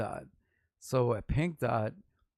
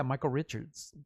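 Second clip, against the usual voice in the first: ordinary delivery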